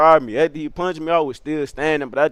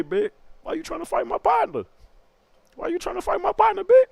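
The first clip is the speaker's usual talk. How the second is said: confrontational cousin. Retold or imitated. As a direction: imitate the accuser in a squeaky falsetto. Voices the cousin confronting him.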